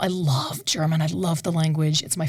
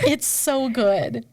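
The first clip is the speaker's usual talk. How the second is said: awed; 5 semitones higher, much slower than usual. Real, not acted. Gushing repeated.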